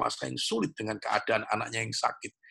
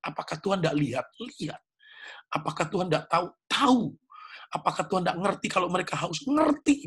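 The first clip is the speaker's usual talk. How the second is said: rising call and answer, each answer higher; ask, then fire back the answer higher each time; preaching at full force; 7 semitones higher than usual. Acted. Pastor to the congregation, answering his own questions, building fervour.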